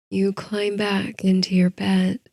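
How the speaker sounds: ordinary delivery, nothing marked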